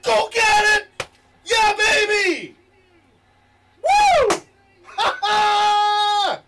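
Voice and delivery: stretched, high screaming cheers; long goal yell. Real, not acted. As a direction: scream with joy. Goal celebration.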